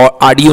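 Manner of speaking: "ऑडियो" with "aa" as its first vowel; mispronounced audio. Shown as a demonstration. Teacher rejecting the students' version, "ऑडियो" mispronounced as "aadio". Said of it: "ऑडियो नहीं होता".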